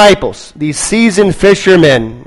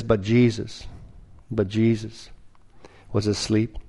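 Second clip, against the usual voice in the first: hushed scripture reading; 6 semitones lower, 14 dB quieter than usual. Acted. Pastor softly reading the verse to the congregation, hushed. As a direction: drop to a near hush for the verse.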